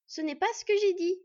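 loud, high-pitched flare of temper; angry outburst. A narrator's reading. Borde protesting at Hull's twisted words, angry outburst. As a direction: lose your temper and protest loudly. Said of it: "s'emporta Borde".

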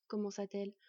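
ordinary delivery, nothing marked

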